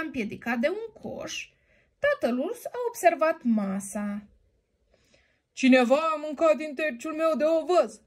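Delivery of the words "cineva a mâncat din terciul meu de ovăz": lightly voiced hoarse father bear, a touch higher; a hint of a gruff papa bear. Papa bear finds his porridge eaten, lightly hoarse voice. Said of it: "cu o voce răgușită".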